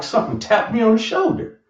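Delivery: ordinary talk, nothing marked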